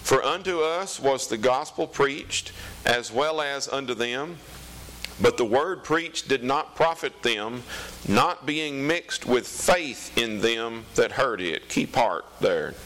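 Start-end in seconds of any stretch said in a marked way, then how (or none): 0.0-11.7 raised, slow solemn Bible reading; scripture reading. Acted. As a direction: read it out like the pulpit. Reads scripture aloud.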